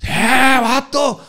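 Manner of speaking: high mock shout of a pal; high friend's call; calling out a buddy. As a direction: holler at him from the crowd.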